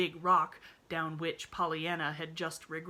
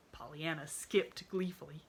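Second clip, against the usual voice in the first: ordinary delivery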